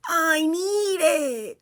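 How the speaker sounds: high, slow, strained gasping plea; desperate SpongeBob. Retold or imitated. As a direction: stretch it out like you're drying up. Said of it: "SpongeBob realizes".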